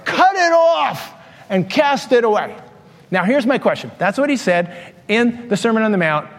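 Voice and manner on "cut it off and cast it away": slow, high, emphatic quote of Jesus; drawn-out scriptural command; quoting scripture. Retold or imitated. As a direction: declaim the command slowly, pitched up. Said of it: "He says".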